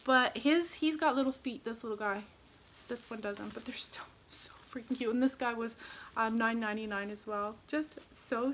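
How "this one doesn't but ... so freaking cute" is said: whispered adoration; gushing whisper. Real, not acted. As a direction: drop to a whisper out of sheer cuteness. Gushing over gnomes.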